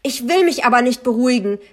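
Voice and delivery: loud, fast, high retort; scolding yell. A narrator's reading. Narrator refuses to calm down, scolding loudly. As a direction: lash back at full voice. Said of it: "keifte ich sie an".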